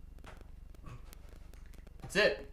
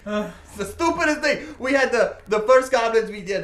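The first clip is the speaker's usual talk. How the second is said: high, loud, worked-up telling; excited storytelling; 7 semitones higher, 8 dB louder than usual. Acted. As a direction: gush about the absurd adventure loudly. Character excitedly tells his goblin tale.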